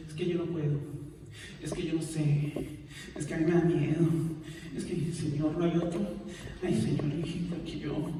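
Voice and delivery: a mild mock whine, making excuses; put-on reluctant voice, barely above a murmur; whiny excuses. Mimicking excuses made to God.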